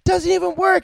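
high-pitched, mock-desperate rant; mock outburst. Retelling The Rock despairing in the jungle, mock tantrum. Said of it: "he, like, really lets off".

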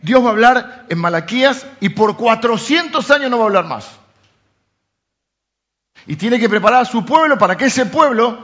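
high, loud preaching to the church; fervent preaching. Acted. Pastor preaching to his church about the Lord's return, loud and fervent. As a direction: proclaim it like a sermon at full force.

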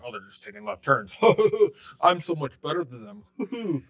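high, smug mocking voice; making fun. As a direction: mimic snooty critics with a fake laugh. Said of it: "making fun of those people".

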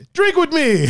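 high, hammed-up Russian accent; cartoonish Russian drinking-buddy accent; bad Russian accent. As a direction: bellow a mock Russian invitation. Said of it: "not very good at a Russian accent".

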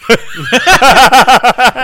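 big burst of laughter; loud laugh. Real, not acted.

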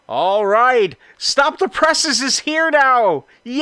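high, loud, drawn-out welcome cheer; excited announcement. Real, not acted. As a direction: hail the guests' arrival at full voice. Announcing guests have arrived.

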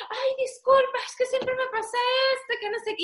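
high, flustered apology; mock apology. Daughter imitates her embarrassed mother apologising, high and flustered.

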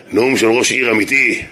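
normal talking voice

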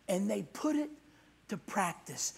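normal talking voice

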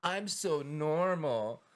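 high, slow self-satisfied drawl; mocking people who pity others to feel better, smug and slow; mock smugness. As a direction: sound pleased with yourself. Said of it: "being like".

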